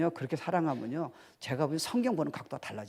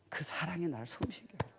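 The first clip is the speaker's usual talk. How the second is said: whisper; 9 dB quieter than usual. Real, not acted. Whispered tender line.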